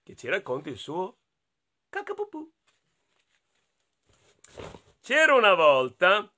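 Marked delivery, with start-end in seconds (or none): 1.9-2.5 high, tiny silly-bunny squeak. Acted. Storyteller introducing the bunny's silly word, squeaked high. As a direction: squeak cacapupù like a baby bunny.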